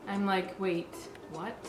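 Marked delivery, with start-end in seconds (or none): none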